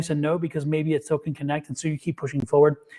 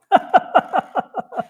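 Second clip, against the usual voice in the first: laugh; 13 semitones higher, 6 dB louder than usual. Real, not acted. Laughs at being wrong.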